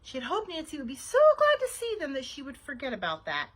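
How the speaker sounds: plain reading voice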